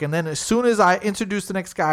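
ordinary talk

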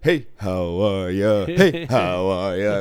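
a goofy cartoon greeting, drawn out and loud; Homer Simpson. Host imitating a cartoon dad entering a casino, slow and loud. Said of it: "Homer Simpson when he walks into".

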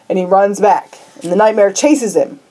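ordinary talk, nothing marked

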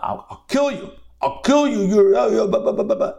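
high-pitched mock rage, sputtering into babble; Balaam. Teacher acting out Balaam upset at the donkey, mock-enraged. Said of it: "Balaam is so upset at the donkey".

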